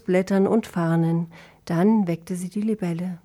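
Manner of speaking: ordinary delivery, nothing marked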